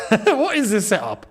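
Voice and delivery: baffled and amused; laughing disbelief. Real, not acted. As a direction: laugh at how absurd it looks. Laughs at the team setup.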